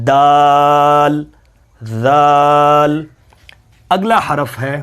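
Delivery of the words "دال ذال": دال then ذال, each drawn out very slowly; slow letter pair. Shown as a demonstration. Teacher contrasts دال and ذال for students. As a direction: stretch both letters, a bit louder. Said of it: "ایک الف کے برابر دونوں کو لمبا کرنا ہے".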